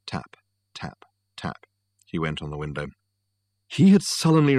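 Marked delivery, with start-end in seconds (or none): 0.1-1.7 hushed, unvoiced taps; whispered tapping. A narrator's reading. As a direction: whisper it like fingers drumming softly. Narrator imitates tapping on window.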